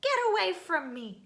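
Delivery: loud, high shooing order; angry dismissal. Acted. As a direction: push him away angrily. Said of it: "cried the Rainbow Fish".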